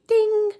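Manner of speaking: high-pitched chime noise; idea ding. Retold or imitated. Traveler lands on renting a car, imitated chime. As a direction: sing out a little "ding".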